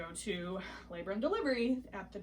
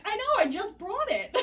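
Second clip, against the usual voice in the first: laughing; 10 semitones higher, 6 dB louder than usual. Retold or imitated. Tells him she brought them.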